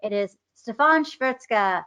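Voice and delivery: normal talking voice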